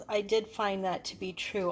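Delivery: ordinary talk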